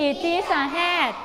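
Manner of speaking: slow, clear sentence for the class; slow model reading. Shown as a demonstration. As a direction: say it slowly so they can follow. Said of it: "ดังๆเลยค่ะ".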